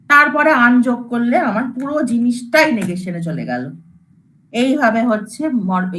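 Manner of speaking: ordinary talk, nothing marked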